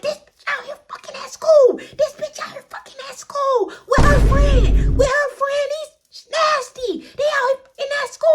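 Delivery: high, loud furious mom shout; mom yelling. Retold or imitated. Mom yelling to Alex about her daughter, high shout. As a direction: scream it as the outraged mom. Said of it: "And she like".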